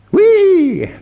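high, loud playful whee; gleeful whee. Pretend. A speaker voicing a spinning feeling, in a gleeful high whee. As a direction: squeal like a kid on a ride.

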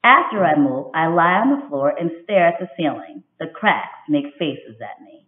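expressive picture-book read-aloud; reading to children. A narrator's reading. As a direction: read it like storytime for young kids. Teacher reads picture book aloud.

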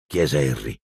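usual speaking voice

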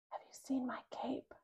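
breathy secret whisper. A narrator's reading. Hiding under the bed, whispering to sister. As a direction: whisper it so no one hears. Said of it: "I whisper".

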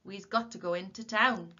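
loud, sharp order; sharp call. A narrator's reading. Tom to dawdling Willie on the road, sharp call. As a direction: hurry him along firmly. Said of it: "called Tom sharply".

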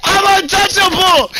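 cocky triumphant yell; gloating shout. Real, not acted. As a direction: brag it out loud. Gloating after surviving.

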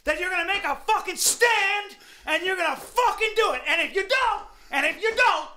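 fervent, heavily stressed outburst; crazed rant. Real, not acted. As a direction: rant like a man possessed. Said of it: "You're sounding like a crazy person".

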